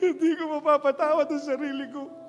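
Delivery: mock grieving wife blaming herself; hammed-up wailing. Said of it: "Umiiyak siyang ganyan".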